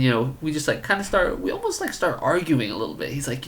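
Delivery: ordinary delivery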